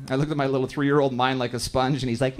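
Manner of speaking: ordinary talk, nothing marked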